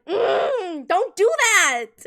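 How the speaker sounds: mock teacher yell, slow and loud; imitating the teacher. Retelling the red-faced teacher, slow mock shout. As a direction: drag out a loud teacher's scolding.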